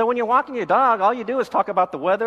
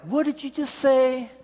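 indignant, pitched up high; mock-offended what did you say; offended retort; 6 semitones higher than usual. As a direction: bristle at the insult. Offended reaction to remark.

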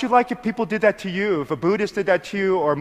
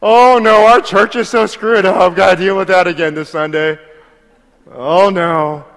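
much louder hammed-up whine about church; loud mock complaining; 8 dB louder than usual. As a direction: parody a weary churchgoer dreading Sunday. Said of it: "That's like the world".

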